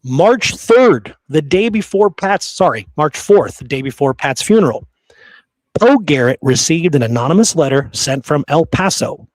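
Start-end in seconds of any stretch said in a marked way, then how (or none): none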